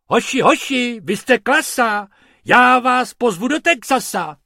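loud, high rhymed cheer; calling out. Acted. Mr Pumpernágl cheers on the rabbits, loud and high. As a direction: shout out praise, loud and high. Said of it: "pan Pumpernágl volal".

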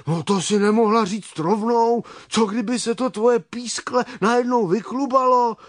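high, agitated bumblebee voices; urgent alarm. Acted. Bumblebees jumping up to rescue the chick, alarmed urgency.

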